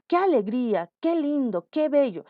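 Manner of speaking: bright-pitched, punchy demo exclamations; exclaiming. Shown as a demonstration. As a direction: exclaim each phrase with a falling tune. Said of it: "Queremos exclamar todas sus características". (exclamando con entusiasmo de ejemplo)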